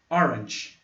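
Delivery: Orange with a crisp tch at the end; English orange. Shown as a demonstration. Teacher repeating Orange for the class, clear demo. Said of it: "O som aqui é tch".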